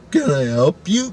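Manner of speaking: high, slow, puzzled question; retold bewildered hello; baffled greeting. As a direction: stretch it out high at a baffling visitor. Retells greeting a strange midnight visitor.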